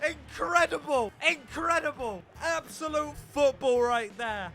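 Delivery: high, loud goal celebration; excited shouting. Real, not acted. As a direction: yell it out after a great goal.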